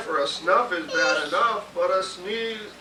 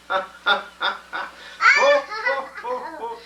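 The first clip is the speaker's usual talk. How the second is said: high loud ho ho ho; elephant's big laughter; 13 semitones higher, 4 dB louder, slower than usual. Acted.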